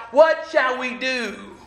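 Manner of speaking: slow, drawn-out cry of worry. Acted. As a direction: plead it slowly, afraid. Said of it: "he cried out".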